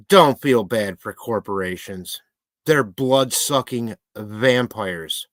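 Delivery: slow, drawn-out warning; slow emphatic rant. Real, not acted. Warning viewers about corporations, slow and emphatic. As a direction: stretch every word to drive it home.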